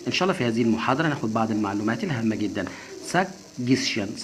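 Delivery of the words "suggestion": "Suggestion" drawn out very slowly, every syllable spelled out; slow model pronunciation. Shown as a demonstration. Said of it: "Suggestion يعني اقتراح".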